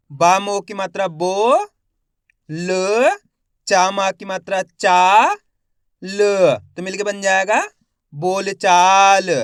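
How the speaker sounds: slow spelling drill, each syllable held with a rising end; stretched syllables for beginning readers; letter-by-letter sounding out. Shown as a demonstration. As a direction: sound out each letter, then blend the word. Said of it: "एक अक्षर का उच्चारण करके".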